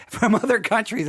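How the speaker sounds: laugh, then say "From other countries" again with care; careful rewording. Shown as a demonstration. Host rewording for listeners from Europe, laughing. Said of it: "I gotta be very careful how I say it".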